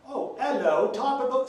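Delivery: lightly put-on British, high and chirpy; a hint of a stock English greeting. Trying a native British accent for friends. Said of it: "I sound like a stereotype".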